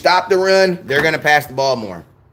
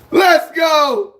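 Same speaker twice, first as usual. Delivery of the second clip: excited shout; 12 semitones higher than usual. Real, not acted. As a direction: belt it out with joy. Opening fan gift box.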